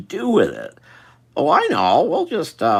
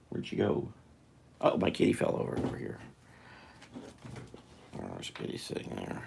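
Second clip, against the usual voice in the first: hushed talk to cat; 10 semitones lower, 13 dB quieter than usual. Real, not acted. Talking softly to the cat.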